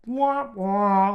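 comic falling wah-wah; sad trombone sound. Pretend. Comic punctuating his dark joke, mock trombone wah-wah. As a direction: imitate a failure jingle. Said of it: "That sound should happen".

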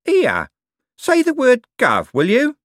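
high, loud, slow suspicious demand. A narrator's reading. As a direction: challenge him, slowly and warily. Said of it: "said in a suspicious voice".

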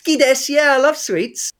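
high-pitched mock kid voice; eager child interviewee. Co-host plays a child answering about sweets, high put-on voice.

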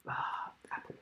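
whispered to himself. Real, not acted. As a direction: breathe it out, thinking. Thinking hard, to himself.

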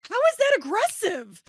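very high, loud incredulous protest; indignant outburst. Retold or imitated. Author retelling her protest at being called aggressive, indignant. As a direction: squawk it in exaggerated disbelief.

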